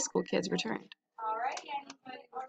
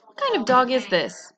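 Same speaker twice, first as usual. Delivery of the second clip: louder, a suspicious trainer's voice; gruff trainer; 3 semitones higher, 5 dB louder than usual. A narrator's reading. Trainer questioning the strange dog, suspicious and louder. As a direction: demand it like a wary dog trainer. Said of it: "said the trainer".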